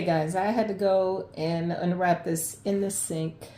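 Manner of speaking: ordinary talk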